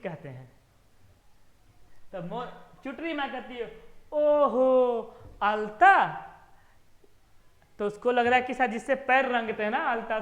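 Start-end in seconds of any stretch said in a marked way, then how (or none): 4.1-5.9 loud drawn-out oho, then loud alta; light up as if it suddenly makes sense; loud, stretched aha of discovery; surprised realisation. Acted. Wife realising what lipstick is.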